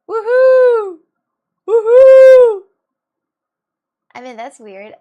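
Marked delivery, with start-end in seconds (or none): 0.1-2.6 very loud, high whoop; yawning woohoo. Shown as a demonstration. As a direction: let a yawn swell into a big woohoo. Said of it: "I just yawned and at the same time I did woohoo".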